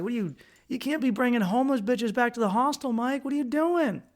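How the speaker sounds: high-pitched mock scolding of himself; mock self-scolding. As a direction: scold yourself in a squeaky mock voice. Scolding himself in retold thought.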